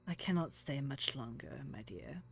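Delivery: soft, sighed farewell; sigh, then speak softly with a touch of sorrow; mild sadness. Acted. Ophelia tells Verbena time is short, a little sadly. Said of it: "sighs a little bit sadly".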